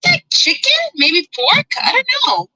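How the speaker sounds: normal talking voice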